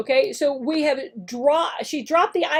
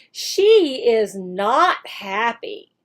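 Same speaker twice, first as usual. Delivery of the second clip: slow correction; 2 semitones lower, much slower than usual. Shown as a demonstration. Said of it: "teacher acts out the lesson".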